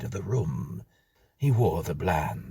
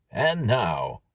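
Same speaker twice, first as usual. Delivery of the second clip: louder, showy American record-host intro; hint of a brash American MC; American announcer; 5 semitones higher, 5 dB louder than usual. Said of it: "said an American voice on the record".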